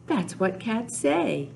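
ordinary talk, nothing marked

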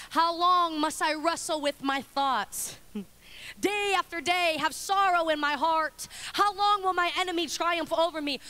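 lifted, earnest reading of scripture. Acted. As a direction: read the psalm like a lament. Reads the psalm aloud.

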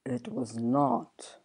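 low, very slow contradiction; deep voice. A narrator's reading. Unseen goblin contradicts the sexton, deep voice. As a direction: drop low and draw each word out. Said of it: "said a deep voice".

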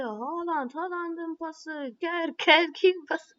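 hammed-up commentator. Hammed-up repeat of the call.

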